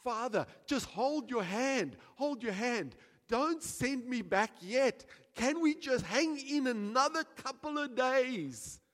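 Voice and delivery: Jesus pleading with the Father, slow and earnest. Acted. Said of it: "and he's saying".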